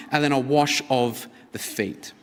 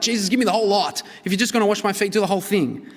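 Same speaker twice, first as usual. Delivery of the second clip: eager Peter; 6 semitones higher, much faster than usual. Retold or imitated.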